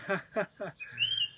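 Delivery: very high squeaky giggle. A narrator's reading. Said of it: "he giggled to himself".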